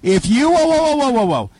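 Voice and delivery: high stern whoa whoa; mock-stern whoa. Real, not acted. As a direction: halt the caller, mock stern. Host halts caller, mock stern.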